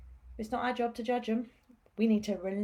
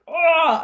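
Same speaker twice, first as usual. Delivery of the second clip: loud, raised burst of pent-up anger; retold angry outburst; 6 semitones higher, 12 dB louder than usual. Healer acting out throwing her fireball of anger, mock angry roar. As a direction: imitate hurling out your rage. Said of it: "I just wanna go".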